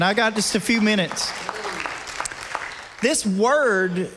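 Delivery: a pastor driving the point home; preaching. Acted. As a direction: preach it line by line, letting each "This word" swell. Preaching the word.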